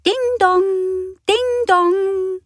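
high sung doorbell chime; doorbell ding dong. Acted. Narrator imitating the front doorbell, high sung chime.

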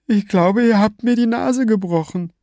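high, strained, sneering thug voice; villain's rant. A narrator's reading. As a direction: taunt her from high in the throat. Villain with hurt nose.

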